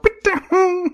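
high whistling ricochet noise; high zing of a ricochet; ricochet whine. Retold or imitated. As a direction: imitate a bullet zinging off metal, pitched high.

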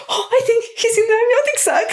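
high, quick, laughing realisation; delighted midwife. Retold or imitated. Midwife guessing the baby is in the sac, high and laughing. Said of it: "She's like".

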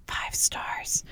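soft aside whisper. Acted. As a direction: whisper the plug. Whispered plug for ratings.

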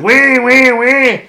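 mock Kawasaki engine whine; laughing high engine whine. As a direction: whine like a sport bike, laughing. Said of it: "The other guy's like".

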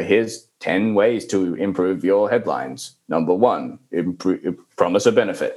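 low, slow monotone reading; dreary listicle recital; boring textbook. Acted. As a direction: read it out like a dull textbook. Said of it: "it's, like, just really boring".

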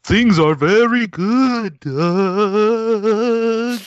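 high, slow, eerie ghoul voice; spooky character voice. Acted. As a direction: drag it out like a creepy spook. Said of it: "on a spooky character".

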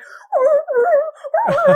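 high, loud imitated puppy whines; terrible puppy sounds. Prankster faking the lost dog on the phone, puppy whines. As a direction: yelp like a puppy in distress. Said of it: "making these terrible puppy sounds".

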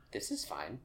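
quiet, deadpan, as the dog in the burning room; a lightly voiced calm dog amid the fire; This is fine dog voice. Friend quoting the meme dog, quiet and deadpan. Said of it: "your "This is fine" dog in the fire voice".

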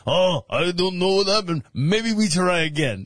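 a put-on Russian man, half shouting; mock Russian accent. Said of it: "I don't know why we went Russian".

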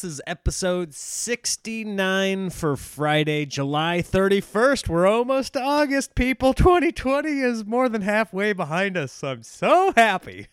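loud, high, upbeat show opener; podcast intro. Acted. Host greeting podcast listeners, loud and high energy.

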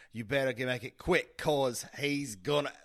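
imitating her Brooklyn accent, loud; a hammed-up Brooklyn read of "gonna"; mock Brooklyn accent. Shown as a demonstration. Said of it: "what she says is".

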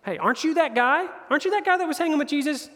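high-pitched questioning bystander; suspicious bystander. Retold or imitated. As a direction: call someone out, pitch climbing on the question. Bystander accuses Peter in retold story.

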